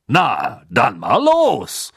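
drawn-out jovial send-off; Bagman voice. A narrator's reading. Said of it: "sagte Bergmann und strahlte".

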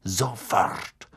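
low, drawn-out threat; most menacing. A narrator's reading. Snape cuts off Ron's protest, low and menacing. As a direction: make one word a dark threat. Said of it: "in seinem bedrohlichsten Tonfall".

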